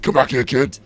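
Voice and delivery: put-on high, loud playful yell after a fleeing kid; mock shout. Killer yelling after the fleeing kid, loud and high. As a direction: bellow it as the hunter.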